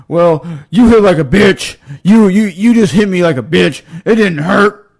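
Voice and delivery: mocking bully. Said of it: "he pops up and goes".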